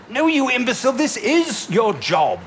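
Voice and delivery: exasperated, cutting put-down; angry rebuke. Acted. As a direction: scold the fool down the line. Exasperated phone call, insulting the caller.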